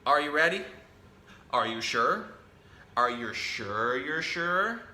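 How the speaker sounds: ordinary delivery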